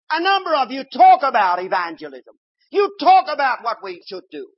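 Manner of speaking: loud, high, fervent preaching; full-force preaching. Acted. Preacher exhorting his congregation, loud and fervent. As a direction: rouse the whole congregation.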